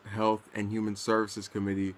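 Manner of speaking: ordinary talk, nothing marked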